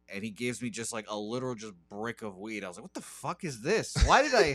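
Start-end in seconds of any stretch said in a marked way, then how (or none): 2.8-4.5 higher, laughing disbelief; half-laughing at his own shock; mock shocked disbelief. Retelling the weed purchase to the cohost, laughing shock.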